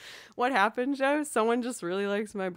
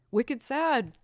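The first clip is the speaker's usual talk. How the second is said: Boston accent, "Wicked sad!" punched out louder; playful Boston accent; 5 semitones higher, 8 dB louder than usual. Shown as a demonstration. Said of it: "talking like I'm a full-on Bostonian".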